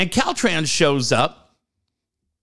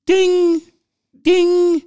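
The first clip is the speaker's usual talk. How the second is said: high, loud chime imitation; ding it out like a seatbelt alarm; mock alarm ding; 12 semitones higher, 6 dB louder than usual. To his friend, mimicking the seatbelt chime, high and loud.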